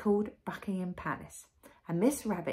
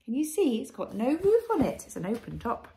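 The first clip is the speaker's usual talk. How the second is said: ordinary delivery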